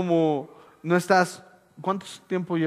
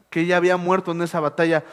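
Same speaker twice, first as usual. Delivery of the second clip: ordinary talk, nothing marked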